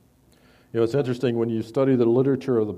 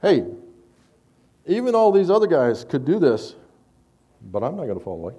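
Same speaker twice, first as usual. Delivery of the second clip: a hint of Peter boasting, high and loud at first; boastful Peter; 5 semitones higher, 5 dB louder than usual. Preacher voicing Peter boasting before the other disciples, lightly acted. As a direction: brag to the others that you alone won't fall. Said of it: "Peter's saying".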